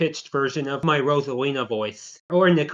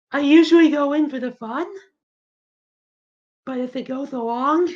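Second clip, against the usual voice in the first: higher-pitched battle-ready character voice; high-pitched Soul Keen character; 12 semitones higher than usual. Acted. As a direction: pitch it up, a playful warning. Plays Soul Keen in skit.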